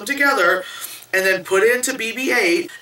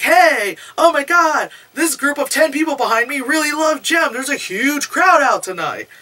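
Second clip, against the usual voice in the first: mock excited fan; 7 semitones higher than usual. Storyteller mimics random guy at last performance, mock excited hype. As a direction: imitate a fan hyping the crowd.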